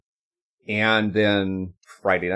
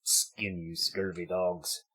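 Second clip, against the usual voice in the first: pirate voice. Pretend. Host teasing fellow players before the pirate game, pirate voice. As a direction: draw out the threat like a pirate. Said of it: "on our pirate voices?".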